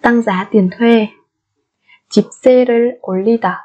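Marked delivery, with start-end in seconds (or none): none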